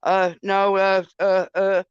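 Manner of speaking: halting string of stalled uhs; mock stammer, getting nowhere; stuttering evasion. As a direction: imitate someone fumbling for an excuse. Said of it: "they just stuttered their way through it".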